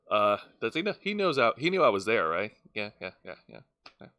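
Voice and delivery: low mumbled impression, fading out; mumbling Orange Cassidy. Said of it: "Orange Cassidy looks over ... saying".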